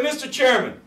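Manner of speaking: very high call to the chair; re-enacted floor call. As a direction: hail the chairman high and insistent.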